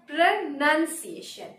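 "প্রনাউন্সিয়েশন" broken up, with "nun" not "noun"; correct pronunciation. Shown as a demonstration. Teacher repeating the correct form, "প্রনাউন্সিয়েশন" with "nun". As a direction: say "প্রনাউন্সিয়েশন" as pro-NUN-see-AY-shun. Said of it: "The correct pronunciation is".

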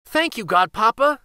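high-pitched grateful exclamation; high, happy little girl; delighted cry. A narrator's reading. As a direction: cry out thanks like a thrilled child. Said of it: "she cried".

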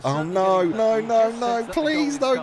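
rising, anxious no's; pleading panic. Real, not acted. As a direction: beg for it not to happen. About to concede again.

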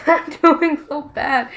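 high, drawn-out wail of complaint; loud lament. Real, not acted. Player bemoaning a bad round, loud lament. Complains about own poor play.

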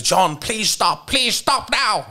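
high, slow, pleading mock teacher voice; imitating the teacher. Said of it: "when the teacher stepped in and said".

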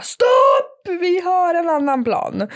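högt, utdraget stopprop; återberättat utrop. Retold or imitated. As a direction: ropa som för att hejda mäklaren, dramatiserat. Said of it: "ringde jag mäklaren och bara". (dramatiskt härmat rop)

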